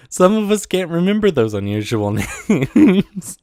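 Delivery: giggles breaking into the words; barely holding it together; laughter bubbling through; laughing. Real, not acted. Laughing while reading silly letter.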